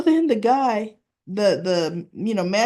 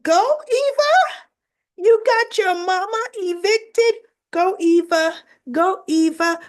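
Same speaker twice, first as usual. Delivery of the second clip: loud, much higher gleeful cheer; a fan shouting praise at the screen, pitched way up; cheering Eva on; 11 semitones higher, 5 dB louder than usual. Retold or imitated. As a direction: whoop her on like a delighted fan.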